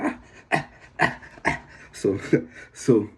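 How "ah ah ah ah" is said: voiceless panting, acted out; imitated sprint panting. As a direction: pant as if after a sprint. Imitates panting after full-speed sprint.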